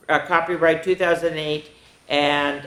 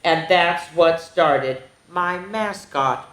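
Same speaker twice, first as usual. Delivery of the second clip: higher, lively read-aloud voice; animated picture-book reading; storybook narration; 3 semitones higher than usual. Acted. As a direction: tell it to a class of kids. Reads the turkey's story.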